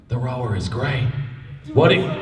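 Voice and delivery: usual speaking voice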